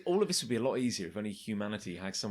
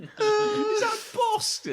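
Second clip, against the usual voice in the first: silly gawping voice; 5 dB louder, much slower than usual. Pretend.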